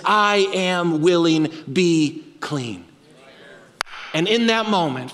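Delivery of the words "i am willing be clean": very slow, unhurried promise; Jesus healing the leper, slow and steady; calm and deliberate. Acted. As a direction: let each word land slowly. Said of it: "he says".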